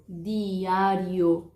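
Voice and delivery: Diário with a clean Spanish d, no dj sound; Spanish demo word. Shown as a demonstration. Teacher models Spanish diário for Brazilians. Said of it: "em espanhol fala diário".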